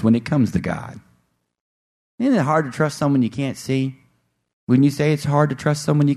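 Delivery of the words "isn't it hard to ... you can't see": ordinary talk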